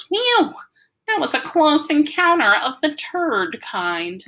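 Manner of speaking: high and slow for the joke; Freak's voice. A narrator's reading. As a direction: lift the pitch way up and drawl the punchline.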